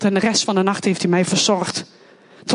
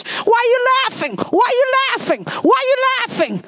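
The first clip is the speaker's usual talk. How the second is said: much higher, repeated demanding question; imitating her ex; 12 semitones higher than usual. Her ex, suspicious of her laughter, asking much higher. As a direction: throw his suspicious question back at her, pitched high. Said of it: "zegt mijn ex".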